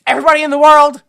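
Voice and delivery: loud, high wrestling call-out; hype announcer shout. Pretend. Hyping up his friend's fighter, loud announcer shout. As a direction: bellow it like a ring announcer. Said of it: "You call people out".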